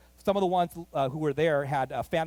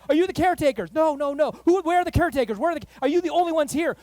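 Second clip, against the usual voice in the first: loud, high pressing questions; officer at the door demanding answers, loud and high; urgent officer; 12 semitones higher, 9 dB louder than usual. Retold or imitated. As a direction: fire questions at him fast.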